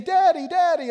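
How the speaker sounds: put-on high, loud little boy calling; toddler calling out. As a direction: call out like a thrilled little grandchild. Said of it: "he's saying".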